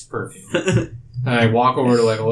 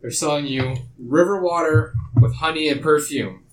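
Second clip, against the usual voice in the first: raised, slow, higher public announcement; loud proclamation; 4 semitones higher, slower than usual. Acted. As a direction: proclaim it to a whole crowd. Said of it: "I get up and I proclaim".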